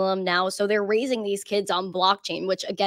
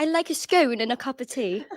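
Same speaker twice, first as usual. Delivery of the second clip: attempted London accent, pitched higher; 5 semitones higher than usual. American guest trying a British line for the host, London accent. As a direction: try a higher-voiced London English accent. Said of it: "I'd go for a London accent".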